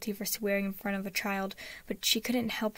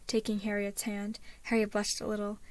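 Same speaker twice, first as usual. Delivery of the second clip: ordinary delivery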